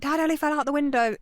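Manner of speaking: sisters rushing to tell dad; panicked call. Retold or imitated.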